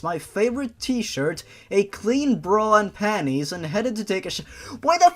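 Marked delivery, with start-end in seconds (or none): 4.8-5.1 high, loud, exasperated yelling at the text; screaming frustration, high and loud; furious rant. Real, not acted. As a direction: blow up at the writer, fed up. Reader's angry rant at the story.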